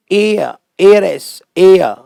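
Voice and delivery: एयर said like the word air, no H; silent H. Shown as a demonstration. Teacher modelling heir and heiress, drawn-out silent-H एयर. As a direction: slowly model एयर, एयरस starting on the vowel. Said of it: "जो हवा का उच्चारण होता है".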